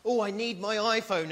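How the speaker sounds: playful mock iPhone craving. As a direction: whine like you can't live without it. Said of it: "forever you're going like".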